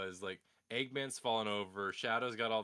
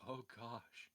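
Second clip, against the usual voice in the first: horrified murmur; 11 dB quieter than usual. Retold or imitated. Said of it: "was like".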